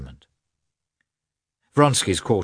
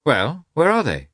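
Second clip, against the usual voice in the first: high-pitched, insistent query; pressing question; 9 semitones higher, 4 dB louder than usual. A narrator's reading. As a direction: demand to know where they are. Vronsky asks back impatiently.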